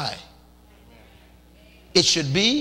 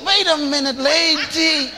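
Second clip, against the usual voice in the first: higher, louder protest; husband pushing back; 10 semitones higher, 7 dB louder, slower than usual. Retold or imitated. Tired husband answers nagging wife, raised protest. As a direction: hold her off, rattled.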